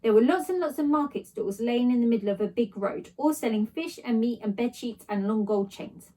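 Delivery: usual speaking voice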